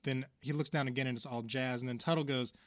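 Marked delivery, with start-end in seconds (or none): none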